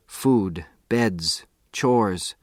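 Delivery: ordinary delivery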